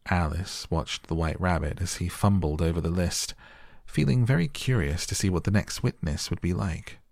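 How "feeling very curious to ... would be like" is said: usual speaking voice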